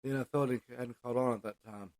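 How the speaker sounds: usual speaking voice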